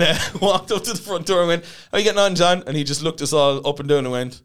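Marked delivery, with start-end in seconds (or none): 1.9-2.6 bright, higher, louder hello to the doorman; cheery greeting. Retold or imitated. Walking up clean to greet John, cheery and louder. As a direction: breeze up to the door all friendly.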